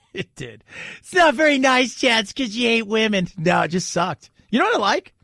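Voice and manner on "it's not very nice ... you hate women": higher, whiny scolding voice; mock critic. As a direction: scold in a put-on high voice. Mocks an imagined critic scolding him.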